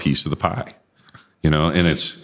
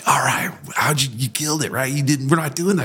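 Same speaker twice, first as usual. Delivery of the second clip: mock soldiers; 9 semitones higher, 3 dB quieter than usual. Soldiers questioning him, high voice.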